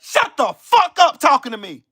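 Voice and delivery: ordinary talk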